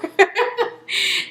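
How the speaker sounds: airy high giggle; high airy laugh after the quote; high breathy laugh. Real, not acted. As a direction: let a breathless laugh escape.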